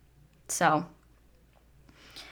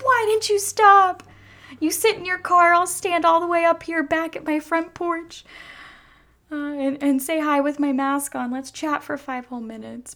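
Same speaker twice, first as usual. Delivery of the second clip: excited retelling; 9 semitones higher, 5 dB louder than usual. Retold or imitated. Retelling what she told friends.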